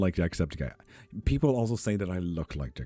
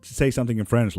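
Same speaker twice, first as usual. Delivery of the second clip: mock excited friend; 5 semitones higher, 6 dB louder than usual. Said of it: "it's already a difficult thing".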